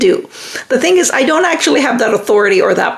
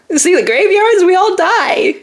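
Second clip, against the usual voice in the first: laughing; 8 semitones higher than usual. Real, not acted. Dark joke about graveyards.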